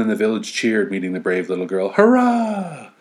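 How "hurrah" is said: very high, loud hurrah; high loud joyful cheer; villagers cheering. Acted. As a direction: cheer like a happy crowd.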